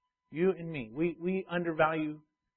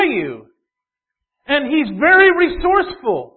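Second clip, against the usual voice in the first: high, loud, emphatic preacher; full-force preaching; 9 semitones higher, 13 dB louder than usual. Real, not acted. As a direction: drive the warning home at full volume.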